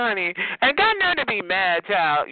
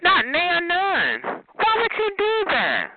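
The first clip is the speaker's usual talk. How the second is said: high, drawn-out mock disbelief; mock dismay; 9 semitones higher, slower than usual. Teasing someone with no teeth, high mock dismay. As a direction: wail it in pretend dismay.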